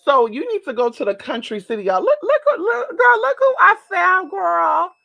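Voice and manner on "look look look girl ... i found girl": very high, loud, laughing squeal; excited squeal. Real, not acted. Vlogger shows viewers a candle, high laughing squeal. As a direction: shriek with delight at the find.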